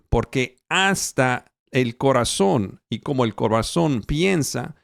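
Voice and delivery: normal talking voice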